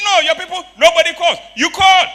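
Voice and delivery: very high, very loud complaint; angry shouting. Retold or imitated. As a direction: yell in disbelief at the caller. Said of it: "He started shouting".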